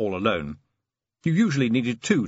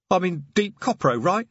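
nervous troll; 8 semitones higher than usual. Acted. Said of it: "He looked around nervously".

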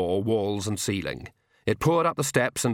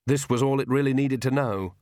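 ordinary delivery

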